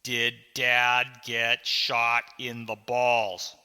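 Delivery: very slow, loud, word by word; insistent. Acted. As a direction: hammer each word with a pause after it. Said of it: "I asked again".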